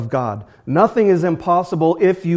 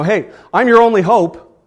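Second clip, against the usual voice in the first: high, loud mock boast; boastful promise; 8 semitones higher, 10 dB louder than usual. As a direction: brag that you're the only hope, hammed up. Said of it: "for someone to say".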